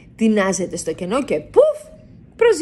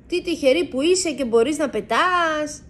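a lightly voiced little mouse, higher and awed; impressed; 9 semitones higher than usual. A narrator's reading. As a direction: admire the bat's big wings. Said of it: "εντυπωσιασμένος".

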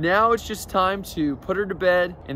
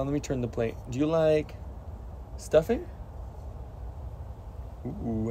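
usual speaking voice

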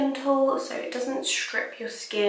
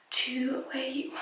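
trailing off into a whisper; 3 semitones higher, 8 dB quieter than usual. Real, not acted. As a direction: drop to a whisper, unsure. Trails off confused mid-sentence.

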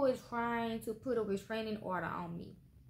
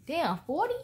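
surprised outburst; 7 semitones higher, 6 dB louder than usual. Real, not acted. Surprised at card count.

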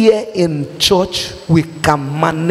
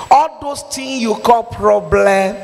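ordinary talk, nothing marked

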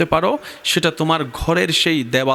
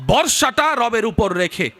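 fiery preaching; 9 semitones higher than usual. Acted. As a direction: preach it at full force, rising in pitch. Preacher rousing the crowd. (উচ্চস্বরে জোরালো ওয়াজ)

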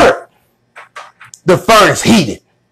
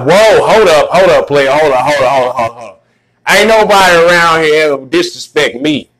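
much lower, indignant king voice; angry king; 7 semitones lower than usual. Retold or imitated. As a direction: play the offended king halting everyone. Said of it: "He was like".

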